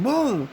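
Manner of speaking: high shouted boom; gunshot boom. Retold or imitated. Imagining a bullet under the nose, high boom.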